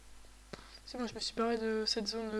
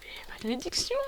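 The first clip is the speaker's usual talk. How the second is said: malédiction soufflée sans voix; chuchoté; 2 semitones higher than usual. Real, not acted. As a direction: plainte tragique tout bas. Plainte chuchotée. (chuchotement dramatique)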